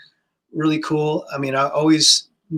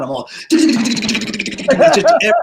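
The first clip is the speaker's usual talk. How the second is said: laughing, rattling mouth drum fill, slow; imitated drum fill; 10 semitones higher, 8 dB louder, much slower than usual. Retelling playing his hero's drum kit, laughing mouth drum fill. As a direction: tap out a fill with your tongue while laughing.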